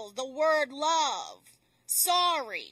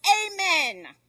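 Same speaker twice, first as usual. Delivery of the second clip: higher, louder cry to stop; raised, urgent storyteller's yell; 4 semitones higher, 5 dB louder than usual. A narrator's reading. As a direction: yell it to halt the horse. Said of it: "and the man yells".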